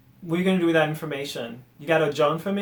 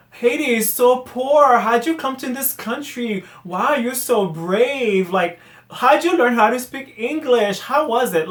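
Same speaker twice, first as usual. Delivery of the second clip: high, drawn-out mock pity; loud singsong imitation of patronizing sympathy; condescending mimicry; 6 semitones higher, 4 dB louder than usual. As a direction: gush with overblown admiration, mocking the askers. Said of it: "so condescending".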